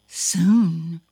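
usual speaking voice

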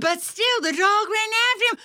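very high, double-speed storytelling; frantic chase narration. A narrator's reading. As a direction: gabble breathlessly as the chase peaks.

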